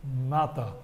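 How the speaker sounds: ordinary talk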